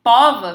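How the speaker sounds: Pova with open stressed o, "póva". Shown as a demonstration. Teacher testing an invented feminine, open o in Pova. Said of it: "ficou aberto o timbre".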